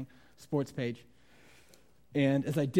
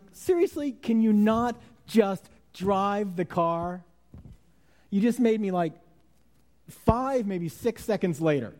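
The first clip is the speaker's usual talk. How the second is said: loud, high, exasperated rant; irritated; 7 semitones higher, 7 dB louder, slower than usual. Retold or imitated. Preacher acting out his rant at a slow driver, irritated. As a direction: replay a driver's outburst, stretched out in disbelief. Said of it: "I'm really irritated. I am. I'm like".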